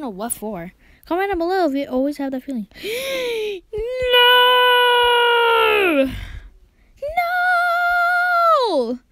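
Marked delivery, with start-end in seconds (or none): 2.7-4.1 sharp gasp. Real, not acted. Game turns against him. 4.1-9.0 high, loud cry of no; shrieking no, much higher; anguished shout. Real, not acted. Losing the game, cries out.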